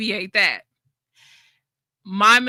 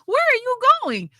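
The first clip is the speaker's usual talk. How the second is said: much higher, slow whiny please please; mock pleading; 13 semitones higher, 4 dB louder, slower than usual. Mocking a begging creator to leaving subscribers, hammed-up pleading. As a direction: beg in a hammed-up desperate voice. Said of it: "I can't fight you and say".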